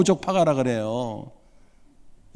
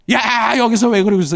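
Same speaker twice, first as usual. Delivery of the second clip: louder, higher sharp scold; angry scolding; 7 semitones higher, 6 dB louder than usual. Shown as a demonstration. Dad finding his son under the wisteria, angry scold. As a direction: bark at the kid as if about to blow up. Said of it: "이렇게 화를 내면은 튈까 봐".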